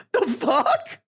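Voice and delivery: high-pitched incredulous laughing. Real, not acted. Streamer reacting to a wild story, incredulous. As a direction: squeak it through a laugh in disbelief. Shocked reaction to the mom's behaviour.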